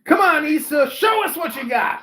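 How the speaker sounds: loud cheering shout. Real, not acted. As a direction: cheer her on at full volume. Cheering Issa on from afar.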